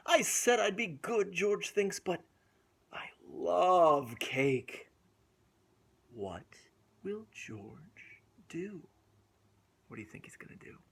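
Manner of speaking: lively storybook reading with gasps; reading to children. A narrator's reading. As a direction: read it aloud to little kids. Reads picture book to children.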